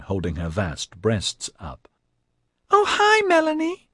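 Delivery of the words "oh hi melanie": put-on very high, squeaky girlish greeting. A narrator's reading. Tiger Pansy greets Melanie backstage, squeaky. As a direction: squeak it out, pitched way up. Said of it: "she squeaked".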